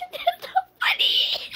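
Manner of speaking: very high, drawn-out whimpering squeal; tearful protest. Real, not acted. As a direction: squeak it out between laughing and crying. Scared friend protesting through tears.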